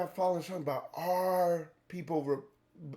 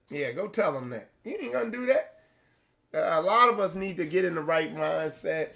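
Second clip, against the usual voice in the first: ordinary talk, nothing marked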